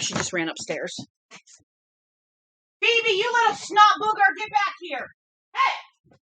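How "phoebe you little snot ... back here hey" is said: much higher, louder exasperated call; real scolding shout up the stairs; yelling after the dog. Real, not acted. Chasing the dog upstairs.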